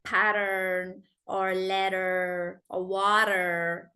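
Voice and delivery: American flapped T in "pattern", "letter", "water"; rolled American T. Shown as a demonstration. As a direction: say "pattern or letter or water" slowly, each middle T softened American-style. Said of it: "then it'll be rolled".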